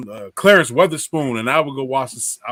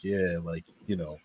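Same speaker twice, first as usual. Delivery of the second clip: mumbled dad; 8 semitones lower, 13 dB quieter than usual. Retold or imitated. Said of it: "he's like".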